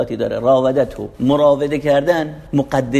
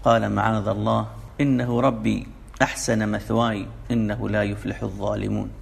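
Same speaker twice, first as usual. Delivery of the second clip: slow, measured recitation of scripture; Quran recitation; 3 semitones lower, 3 dB quieter than usual. Acted. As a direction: recite the verse slowly and evenly.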